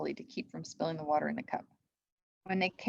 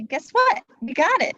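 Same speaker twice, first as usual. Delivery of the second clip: higher and much louder, eager shout; excited calling; 10 semitones higher, 10 dB louder than usual. A narrator's reading. Annie calls her news to the knights, excited and loud. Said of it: "called Annie".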